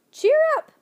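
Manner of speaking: loud, very high squawk; bird squawk. A narrator's reading. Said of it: "she squawked".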